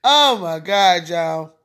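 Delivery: ordinary talk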